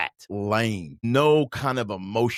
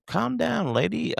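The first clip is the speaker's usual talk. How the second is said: mock manager retort; slower than usual. Manager snaps at narrator.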